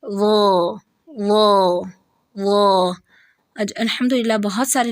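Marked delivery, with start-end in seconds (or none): 0.0-2.9 وہ heavy and full; correct heavy sound. Shown as a demonstration. Teacher giving the correct pronunciation. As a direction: say وہ heavy instead. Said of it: "ایسے نہیں".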